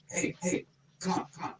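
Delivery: hushed whisper, waving someone in; whispered beckoning. Retold or imitated. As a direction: whisper to sneak someone inside. Beckoning through backstage door.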